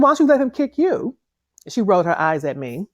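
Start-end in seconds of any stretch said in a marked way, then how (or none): 0.0-1.2 higher, pointed comeback in another voice; retorting to a careless mother; sharp retort. Retold or imitated. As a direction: throw the question back at her.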